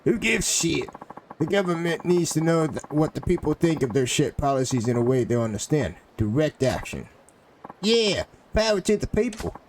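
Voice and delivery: rally the mob against the bureaucrat; angry game townsman. Acted.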